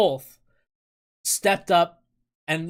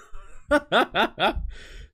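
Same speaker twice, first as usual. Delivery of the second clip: amused, higher-pitched giggling; laugh; 9 semitones higher than usual. Real, not acted. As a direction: bubbly run of high laughter. Laughs at own escape room story.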